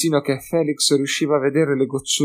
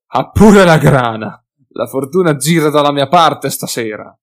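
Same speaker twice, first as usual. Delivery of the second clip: gloating hunter; 3 semitones higher, 10 dB louder than usual. Acted. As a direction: crow over the easy cash. Hunter gloats over the money.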